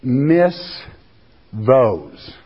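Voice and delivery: "Miss those." split into two slow words. Shown as a demonstration. Preacher slowing down the Greek word for the congregation. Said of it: "Let me say it slower".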